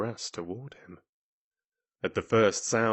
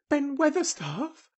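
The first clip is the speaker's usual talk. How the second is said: put-on high-pitched girl's call. A narrator's reading. Mary finding her breath, calling out. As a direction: call up to him, pitched right up. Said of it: "called out Mary, finding her breath".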